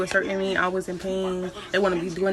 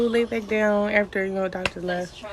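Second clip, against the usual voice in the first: ordinary talk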